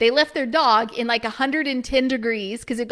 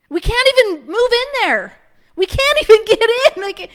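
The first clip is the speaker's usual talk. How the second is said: much higher and louder, laughing in despair; exasperated; 9 semitones higher, 5 dB louder than usual. Real, not acted. To viewers about the unusable house, high exasperated laugh. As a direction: vent the disbelief with a laugh. Despair over ruined dream property.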